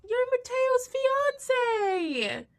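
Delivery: high, drawn-out mock amazement; gasp, then stretch it out high and loud; astonished gasp. Mom spotting the ring on the finger, astonished. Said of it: "the mom sees the ring".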